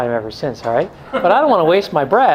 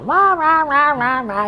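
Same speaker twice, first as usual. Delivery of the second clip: high wordless wah-wah babble; Charlie Brown grown-up; 11 semitones higher, slower than usual. Acted. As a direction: imitate a droning cartoon adult without words. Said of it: "like one of those grown-ups in a Charlie Brown show".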